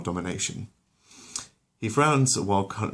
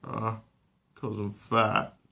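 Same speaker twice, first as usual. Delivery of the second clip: put-on softer, slow ashamed teen; ashamed mumble; 3 semitones higher, 3 dB quieter, much slower than usual. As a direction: admit it in shame. Chris admits shame.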